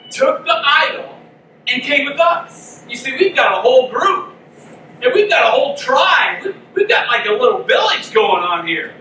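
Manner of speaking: mock a pushy salesman; persuasive salesmen. Five men tempting the priest, a hammed-up salesman pitch. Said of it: "and they're like".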